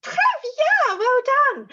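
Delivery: high, loud, thrilled cheer; squealed congratulations; delighted praise. Real, not acted. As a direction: keep the excitement up while praising. Praising children after excited word.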